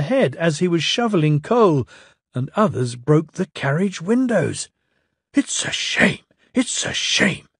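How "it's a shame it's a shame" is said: whispered hiss; angry engine hissing. Acted. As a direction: hiss it through steam. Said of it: "hissed Henry".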